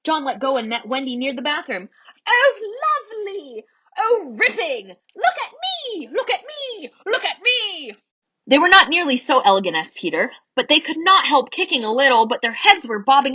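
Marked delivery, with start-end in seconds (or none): 2.3-7.9 high, breathless cries of joy; delighted squeals. A narrator's reading. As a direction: shout for everyone to watch you fly. Children flying with delight.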